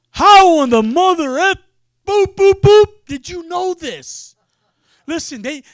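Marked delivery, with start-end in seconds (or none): 0.1-4.4 loud, stunned outburst with bleeped curse; shocked biker. Retold or imitated. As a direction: blurt it out in total disbelief. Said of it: "He's like".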